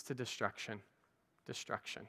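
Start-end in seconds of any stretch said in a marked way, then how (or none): 1.5-2.0 breathy whisper of the single word; ominous whispered repeat; whispered echo. Acted. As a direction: let it fall away to a whisper. Dramatic echo of previous word.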